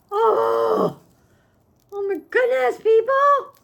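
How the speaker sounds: very high, loud cry of delight; excited shriek. Real, not acted. Shrieking to viewers at a new gift in high excitement. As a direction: burst out shrieking with joy.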